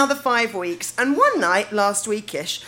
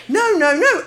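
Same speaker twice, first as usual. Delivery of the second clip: firm loud refusal; 7 semitones higher, 7 dB louder than usual. Retold or imitated. Refusing drunk boy's advances.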